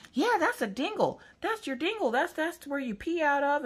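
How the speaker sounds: put-on very high, gleeful little-kid voice; four-year-old girl. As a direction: imitate a small child explaining eagerly. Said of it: "Maggie's all for it, and she's just like".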